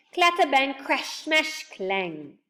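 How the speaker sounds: punchy, noisy list of crashes; crashing sound words. A narrator's reading. Narrator telling of the fall downstairs, punching each crash. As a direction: start high and loud, tumbling downward word by word.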